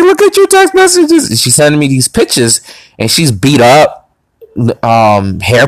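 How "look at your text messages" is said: high-pitched tearful imitation; mock hysterical crying. Girl telling him to check his texts, mock hysterical. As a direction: put on a much higher, hysterical voice. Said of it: "She's hysterical".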